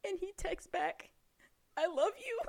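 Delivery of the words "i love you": high, rising "I love you"; tentative mock confession. Friend retelling his awkward text back, tentative. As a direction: lift it high like a nervous question.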